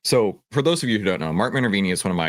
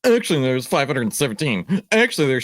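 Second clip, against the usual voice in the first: high, louder nasal-free mock pedantic voice; mocking trolls correcting the stock count, higher; mocking know-it-all trolls; 6 semitones higher, 3 dB louder than usual. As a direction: mimic smug online nitpickers. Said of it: "all the trolls came out and they're like".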